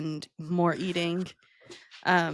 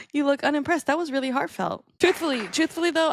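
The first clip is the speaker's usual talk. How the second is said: laughing excited; 7 semitones higher than usual. Real, not acted. Laughing defence of her letter.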